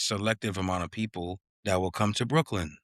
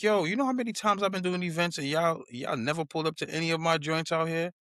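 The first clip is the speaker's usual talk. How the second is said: annoyed confrontation; 9 semitones higher than usual. Retold or imitated. Confronting friends who never came, high and annoyed. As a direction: vent real frustration at the friends. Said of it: "it pissed me off".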